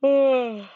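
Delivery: loud sighing yawn. Acted. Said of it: "My mother yawns".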